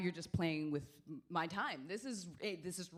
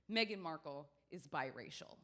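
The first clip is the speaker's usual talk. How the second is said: ordinary talk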